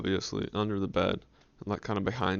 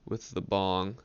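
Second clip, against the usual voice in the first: ordinary delivery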